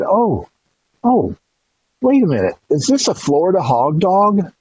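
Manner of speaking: high, rising oh, oh of recognition; the old lady suddenly realizing what it is; old lady's surprise. Retold or imitated.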